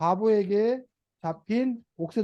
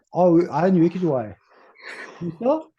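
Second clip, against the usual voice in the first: ordinary delivery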